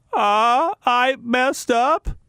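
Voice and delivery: a hint of the coach owning up; mock coach confession, slow and high; Bruce Weber impression. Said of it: "That's my attempted impression of, uh, Bruce Weber".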